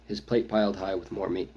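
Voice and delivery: plain reading voice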